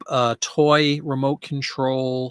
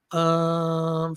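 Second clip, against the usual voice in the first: normal talking voice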